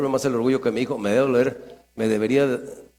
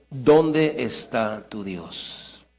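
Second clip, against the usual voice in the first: slow taunt; 6 semitones lower, much slower than usual. Retold or imitated. Quoting the mockers.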